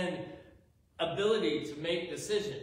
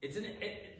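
ordinary talk

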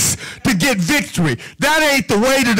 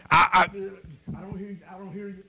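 much softer, mumbled "I don't hear you" trailing away; mock ignoring; 3 semitones lower, 10 dB quieter than usual. Preacher mimicking believers who refuse to answer Satan, softly mumbled.

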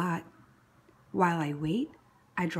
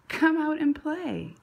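higher, louder eager calling; 8 semitones higher, 4 dB louder than usual. Acted. Big sister calls the baby out to play, eager and high. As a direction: call out to the baby with glee.

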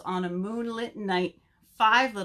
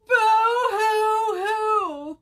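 long, loud, high boo-hoo wail; hammed-up storybook bawling; crying together; 13 semitones higher, 9 dB louder, much slower than usual. A narrator's reading. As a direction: bawl it out high, stretching every hoo. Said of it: "cried Bird's friends together".

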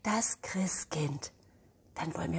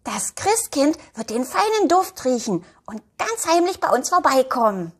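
put-on excited little girl; 7 semitones higher, 5 dB louder than usual. Elfi freut sich aufs Christkind.

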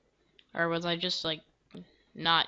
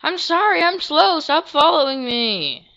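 much higher, louder flustered plea; panicked loud plea; 8 semitones higher, 7 dB louder than usual. Real, not acted. Player pleading with the following guard, high and loud. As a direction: beg the guard off in a squeal.

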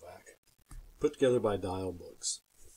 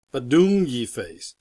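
slow, louder goofy sounding; silly drawn-out phrase; 7 dB louder, slower than usual. Acted. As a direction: stretch it out slowly and loudly. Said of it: "make silly sounds".

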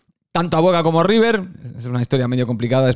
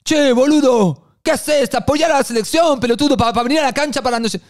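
high, aggressive Argentine shout, much higher; angry Argentine fan; 9 semitones higher than usual. Retold or imitated. Hostile fan confronting the friend, angry Argentine shout. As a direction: turn round and tear into them, Argentine accent. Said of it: "Se nos giran y nos dicen".

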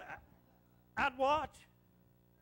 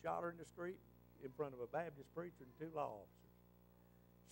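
inmate's confession; 5 semitones lower, 12 dB quieter than usual. Retold or imitated. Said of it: "He said".

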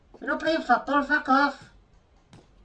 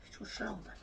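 whispering; 4 semitones lower, 17 dB quieter, faster than usual. Real, not acted. Whispered complaint.

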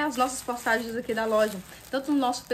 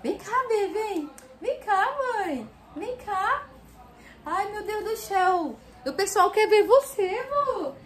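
much higher, louder cooing call; 10 semitones higher, 5 dB louder, slower than usual. Real, not acted. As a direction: coo to a little dog. Cooing to the new puppy. (voz de falar com cachorrinho)

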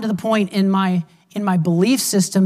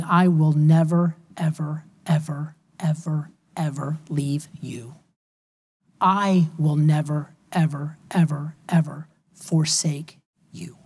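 "never, ever, ever" slowed, each word set apart and hushed; low, soft, deliberate repeats of "ever"; emphatic promise; 4 semitones lower, 4 dB quieter than usual. Retold or imitated. God promising never to leave, quiet solemn emphasis on each "ever". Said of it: "double negative in the Greek is an emphasis".

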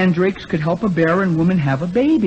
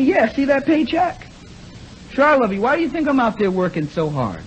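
higher, breezy brush-off; mock husband waving off his wife; dismissive husband; 8 semitones higher than usual. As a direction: toss it off like a smug husband.

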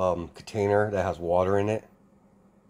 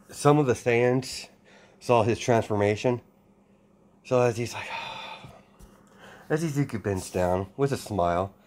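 ordinary delivery

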